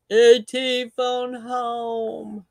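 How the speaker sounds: lightly voiced alien, slow and drawn out. Host imitating E.T. for the chat, slow. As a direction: stretch it out like E.T. Quoting E.T. slowly.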